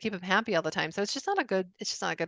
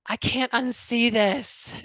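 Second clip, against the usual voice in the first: awestruck reader; 3 semitones higher, 4 dB louder, much slower than usual. Retold or imitated.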